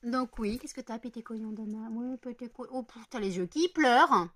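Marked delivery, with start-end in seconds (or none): none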